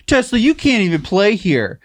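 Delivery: mock tantrum, loud and drawn out; high, slow, hammed-up playground sulk; petulant child. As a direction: whine it like a sore loser taking the ball. Mocking Oklahoma as sulky child.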